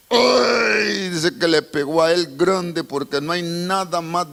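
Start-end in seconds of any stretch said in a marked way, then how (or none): 0.1-1.1 high anguished "ay"; pained cry. Acted. Preacher crying out at the congregation's loss. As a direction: cry out as if it hurts.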